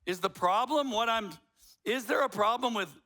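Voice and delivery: ordinary talk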